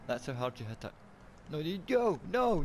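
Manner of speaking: normal talking voice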